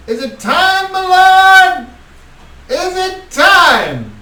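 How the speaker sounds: high, loud, fawning call from the back; eager vampire lackey. Acted. A lackey vampire calling out to his lord, high and fawning. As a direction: squeal it up to your lord, slow and eager.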